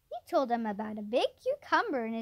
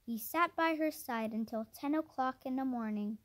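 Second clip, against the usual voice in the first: ordinary delivery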